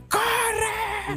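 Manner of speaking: one high, sharp yelled word; retold high yell of run; shouted warning. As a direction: scream it at your friend to run. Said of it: "parece que le dije".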